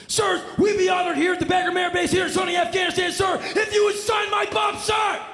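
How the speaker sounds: very high, loud military bellow; shouting soldier. Retold or imitated. Weapons loader shouting a request to sign the bomb. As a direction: bark it like a gung-ho sergeant. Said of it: "has a man said to me".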